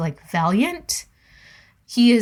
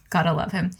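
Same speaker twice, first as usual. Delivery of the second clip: ordinary delivery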